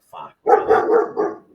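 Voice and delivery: squeaky, slow, drawn-out disbelief; exaggerated disbelief. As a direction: stretch it out high and incredulous. Same reaction, exaggerated.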